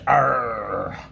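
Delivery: light pirate growl, slow; a hint of a pirate challenge. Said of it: "if Casey Patch".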